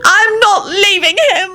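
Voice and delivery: very high, drawn-out refusal; defiant screech. A narrator's reading. Elizabeth refusing to leave Lupin, screeching defiantly. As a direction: screech it, stretching each word. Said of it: "Elizabeth screeched defiantly".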